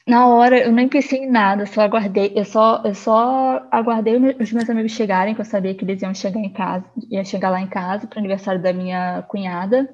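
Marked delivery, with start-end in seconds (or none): 0.1-5.3 panicked. Retold or imitated. Panicking over suspected cancer.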